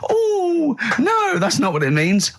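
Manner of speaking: high, squeaky protest; sketch voice. Acted. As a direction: play the offended character. Comic sketch dialogue.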